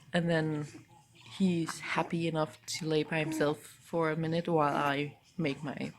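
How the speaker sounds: hushed so the husband sleeps; quiet at night. Real, not acted. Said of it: "That's also why I'm a little bit quiet".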